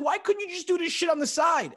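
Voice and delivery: very high, loud mock-wife voice; squealed imitation of an angry wife; upset wife. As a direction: demand it as an exasperated wife, hammed-up. Imitates upset wife demanding an answer.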